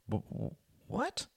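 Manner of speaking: soft, slow stammer on a question. A narrator's reading. Tom is caught off guard by Hart's remark, stammering. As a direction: falter on the first sound before getting it out. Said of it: "stammered Tom".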